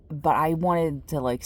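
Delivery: ordinary talk, nothing marked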